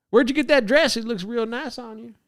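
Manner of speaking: put-on smooth Southern accent. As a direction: lay on the Southern charm. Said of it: "I talk smooth like that".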